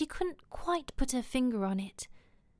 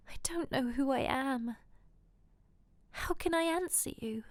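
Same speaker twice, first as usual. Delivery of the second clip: higher, breathy, fearful voice; frightened; 4 semitones higher than usual. A narrator's reading. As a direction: answer in lifted, helpless fear.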